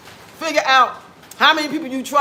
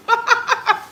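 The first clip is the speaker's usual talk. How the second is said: short high burst of laughter; 10 semitones higher than usual. Real, not acted. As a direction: cackle at your own joke. Laughing at her own joke.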